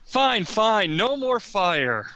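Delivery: playful goblin impression; mock goblin plea. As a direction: parrot the goblin teasingly. Player mimics the goblin's plea.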